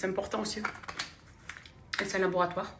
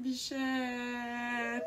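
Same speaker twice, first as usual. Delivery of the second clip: higher-pitched, long-held final syllable; delighted exclamation; 5 semitones higher than usual. Real, not acted. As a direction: draw the word out in a sustained call. Delighted calling to the winner.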